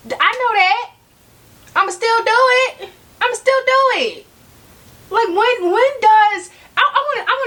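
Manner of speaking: high, bright defiant I know that; defiant glee. Real, not acted. Admitting to viewers she'll buy anyway, high and gleeful. Defiant admission about luxury buys.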